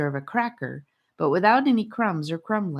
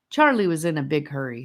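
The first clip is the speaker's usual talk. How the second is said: plain reading voice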